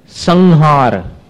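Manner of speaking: संहार said slowly, nasal as ng before ह; slow teaching demo of संहार; anusvara demo. Shown as a demonstration. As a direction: draw out संहार to show the nasal. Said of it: "इस उच्चारण में और संयम इस उच्चारण में कितना अंतर है".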